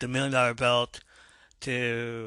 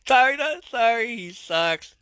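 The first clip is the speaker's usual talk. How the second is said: mock apology; 10 semitones higher, 7 dB louder than usual. Real, not acted. Mock apology about Warrior.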